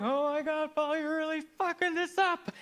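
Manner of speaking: mock disapproval. Child and future self scolding Paul, mock disapproval. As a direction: sigh it like a let-down onlooker. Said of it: "you're both like".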